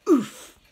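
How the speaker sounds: squashed grunt of air; strained oof. A narrator's reading. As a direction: push out a loud oof as if crushed. Woman squashed by baby.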